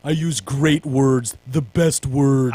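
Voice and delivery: slow, drawn-out mock Trump; a light, slow Trump impression. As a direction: savour each word like Trump bragging.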